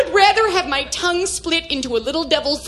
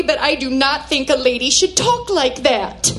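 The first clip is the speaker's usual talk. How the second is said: ordinary talk, nothing marked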